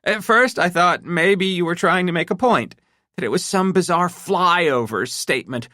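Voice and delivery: high, tense, hurt wife voice; a betrayed wife working herself up; upset wife. A narrator's reading. Wife confronting husband about his secret, tense and hurt.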